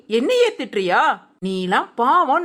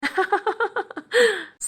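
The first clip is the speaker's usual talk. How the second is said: mocking laugh; 5 semitones higher than usual. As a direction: laugh at her again. [name] laughs again.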